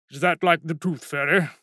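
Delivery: dry, mocking question; sarcastic. A narrator's reading. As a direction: needle him with mock innocence. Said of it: "said the dean sarcastically".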